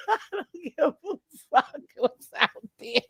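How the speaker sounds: repeats "I don't give a fuck what's out there" through a laugh; cracking up mid-line. Retold or imitated. Telling the family she won't go out, laughing.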